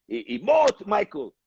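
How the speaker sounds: put-on high, yelled orders in a thick German accent; German director screaming at an actor in a thick accent. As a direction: bark it like a German film director. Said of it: "He was screaming in a thick German accent".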